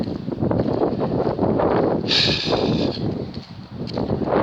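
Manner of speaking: long, heavy exhale; exasperated sigh. Real, not acted. Speaker realising he has gone over ten minutes, long exasperated sigh. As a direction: let out a drawn-out sigh.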